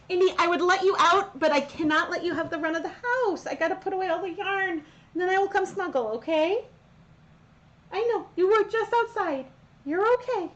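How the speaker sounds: high sweet pet talk; doggy voice. Real, not acted. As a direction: coo at the dog like a pup. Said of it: "if I talk in my doggy voice".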